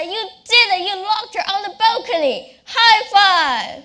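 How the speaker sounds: put-on loud, high, delighted old man; gleeful grandfather. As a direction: cheer like a proud grandpa. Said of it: "with a wide grin, and he said".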